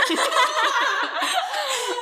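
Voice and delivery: high shrieking burst of laughter. Real, not acted. Laughing hard at the joke about an hour, high shriek laugh.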